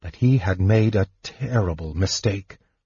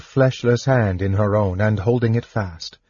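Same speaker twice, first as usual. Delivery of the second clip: ordinary delivery, nothing marked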